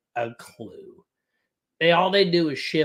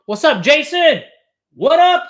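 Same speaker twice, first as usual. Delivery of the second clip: much higher, louder hype greeting; excited greeting; 10 semitones higher, 10 dB louder than usual. Real, not acted. Streamer hyped greeting Jason in chat.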